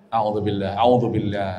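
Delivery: low soft murmured a'udzubillah, over and over; soft repeated murmur. Shown as a demonstration. Preacher showing wife how to pray softly, low murmur. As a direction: murmur a'udzubillah gently to yourself. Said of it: "jangan dengan suara ker".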